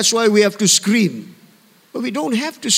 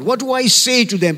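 normal talking voice

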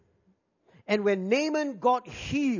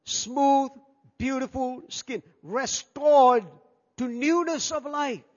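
rising preacher fervour; 5 semitones higher, 5 dB louder, slower than usual. Acted. Preacher building to climax.

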